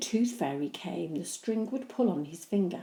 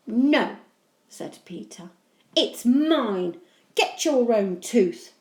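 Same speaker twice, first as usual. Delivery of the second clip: indignant; 5 semitones higher, 9 dB louder, slower than usual. Acted. Peter refuses.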